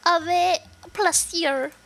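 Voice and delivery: put-on slow, high French accent. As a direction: draw it out in an amateur French accent. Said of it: "she's supposed to be French".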